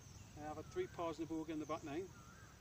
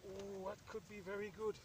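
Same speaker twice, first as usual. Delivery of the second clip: usual speaking voice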